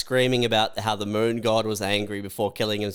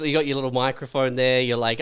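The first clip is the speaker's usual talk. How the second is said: normal talking voice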